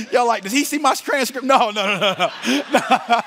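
high, loud, breaking up with laughter; laughing protest. Real, not acted. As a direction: protest helplessly through real laughter. Cracking up at his own slip.

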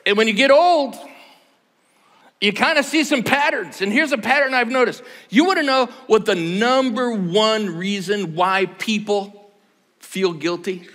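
preacher leaning in; preaching. Acted. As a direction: build the point to the congregation. Building the sermon point.

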